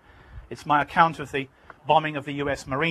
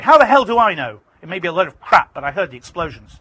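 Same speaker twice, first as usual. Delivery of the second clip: loud, high angry snap; angry retort; 3 semitones higher, 11 dB louder than usual. Retold or imitated. Foley angrily answering the narrator while driving. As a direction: snap back in frustration. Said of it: "Foley's angry".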